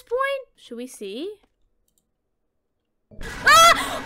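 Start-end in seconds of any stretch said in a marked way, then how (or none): 3.2-4.0 high, loud scream of fright; scared scream. Real, not acted. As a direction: shriek at a jump scare. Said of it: "I still got freaking scared".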